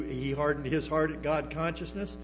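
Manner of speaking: usual speaking voice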